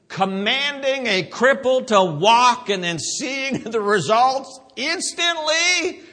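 loud, high, soaring preacher delivery; forceful preaching. Real, not acted. Preacher marvels to congregation at a healing, full-force preaching. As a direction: build it up and ring it out.

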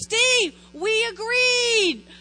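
husband's reminder. Retold or imitated. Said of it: "he goes".

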